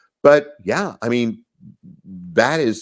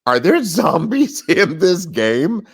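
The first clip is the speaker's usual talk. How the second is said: surprised; 7 semitones higher, 4 dB louder than usual. Retold or imitated. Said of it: "And I went".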